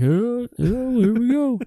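mocking the football gang watching a fight start; mock football buddies. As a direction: hoot it like the gang egging on a fight. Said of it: "his football gang is all like".

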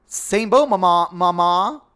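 high, loud imitation of a viral-video woman; straight woman impression. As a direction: mimic her loudly, drawing out each "mama". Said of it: "it's so straight that I can't copy it".

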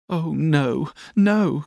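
put-on high, girlish protest; Dora's cry. A narrator's reading. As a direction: plead in a high little voice. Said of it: "cried Dora".

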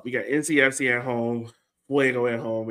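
usual speaking voice

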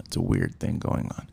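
ordinary talk

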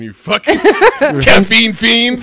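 laughing through a playful insult; cracking up while teasing. Real, not acted. Laughing jab at coffee drinkers.

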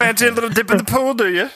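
high, cheeky British invitation; mock British accent. Comic body part invites co-host to swim, cheeky mock British. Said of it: "is fucking British now".

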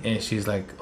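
ordinary delivery

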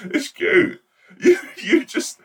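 high squealing laugh through words. Real, not acted. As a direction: giggle it out, high. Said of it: "being disparaging and horrific".

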